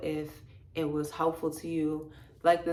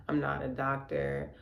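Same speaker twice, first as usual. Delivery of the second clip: ordinary delivery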